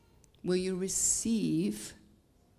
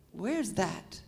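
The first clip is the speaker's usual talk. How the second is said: normal talking voice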